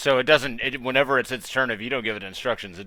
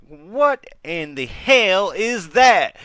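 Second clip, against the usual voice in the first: shocked; 10 semitones higher, 10 dB louder, much slower than usual. Acted. GM voices shocked character.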